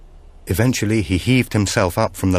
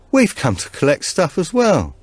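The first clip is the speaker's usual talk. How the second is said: Tommy's voice; 10 semitones higher, 3 dB louder than usual. A narrator's reading. Tommy speaking in the story.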